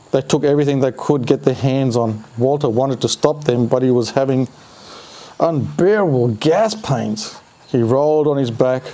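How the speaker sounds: ordinary delivery, nothing marked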